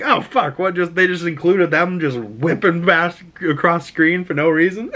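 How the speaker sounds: high, startled outburst; mock surprise. Viewer reacting to Wolverine and Rogue flying past, surprised. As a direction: act caught off guard. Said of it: "and you're like".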